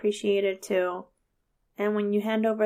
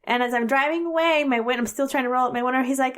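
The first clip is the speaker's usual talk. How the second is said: ordinary delivery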